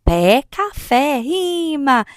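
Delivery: "Pé, café" slow and drawn out on the final é; pointing out rhyme. Shown as a demonstration. Showing children the rhyme, "Pé, café" drawn out. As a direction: stretch "Pé, café" high so the rhyme rings. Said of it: "a criança também vai brincar a identificar rimas".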